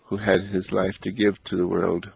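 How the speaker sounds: ordinary delivery, nothing marked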